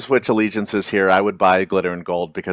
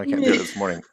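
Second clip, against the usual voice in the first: normal talking voice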